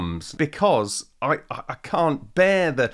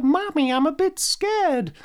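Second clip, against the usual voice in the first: put-on high, slow little boy voice; a small scared child asking mum; 14 semitones higher, slower than usual. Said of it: "It was like".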